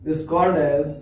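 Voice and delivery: word-by-word dictation with long pauses; slow dictation. Acted. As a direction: dictate slowly for note-takers, repeating phrases. Dictates definition for note-taking. (धीरे-धीरे, रुक-रुक कर श्रुतलेख)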